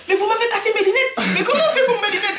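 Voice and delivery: high-pitched mock outrage, laughter in the voice; père en rogne. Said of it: "il avait trop le mort". (père indigné imité en riant, voix aiguë)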